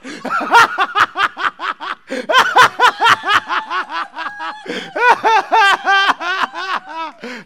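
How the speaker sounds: very high, loud cackles in waves; helpless, shrieking gales of laughter; long laughing fit. Real, not acted. Preacher delighted at double tithe, long uproarious laugh.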